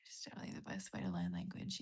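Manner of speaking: very low, hushed read-aloud; soft, private reading; reading under breath. Real, not acted. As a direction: half-whisper a comment to yourself. Reading viewer comment to self.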